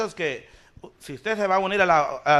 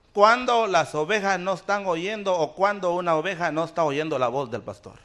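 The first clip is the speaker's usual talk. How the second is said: normal talking voice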